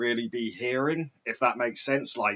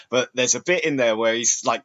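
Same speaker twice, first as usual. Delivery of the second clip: ordinary delivery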